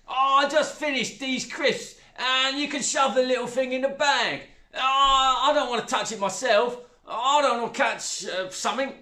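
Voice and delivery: high, loud stepmother voice; squeamish, careless stepmother; coarse stepmother. A narrator's reading.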